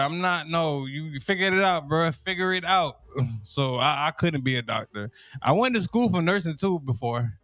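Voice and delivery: usual speaking voice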